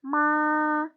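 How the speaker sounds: ma held high, long and level; first tone ma. Shown as a demonstration. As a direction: say ma high and flat.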